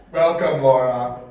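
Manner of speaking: low, menacing villain greeting; Dark Lord voice. Acted. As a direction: greet her like an evil overlord. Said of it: "that's my Mondor Dark Lord voice".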